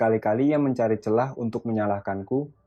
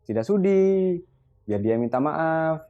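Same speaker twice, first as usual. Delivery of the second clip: higher, outraged "Tidak sudi" that slows on "maaf"; indignant refusal; 6 semitones higher, slower than usual. A narrator's reading. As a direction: refuse with scorn, dragging out the last words. Mother refuses, enraged. (ibu menolak dengan geram dan lambat)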